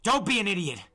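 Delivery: high, loud desperate shout; yell. Acted. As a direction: yell her away from danger. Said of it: "I yelled".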